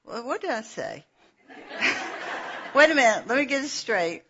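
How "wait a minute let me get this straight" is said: ordinary delivery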